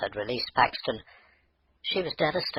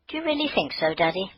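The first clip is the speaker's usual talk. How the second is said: put-on high little girl's voice, hopeful; a small daughter asking her dad, pitched up; little girl Maggie; 8 semitones higher than usual.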